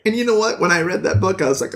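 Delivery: ordinary delivery, nothing marked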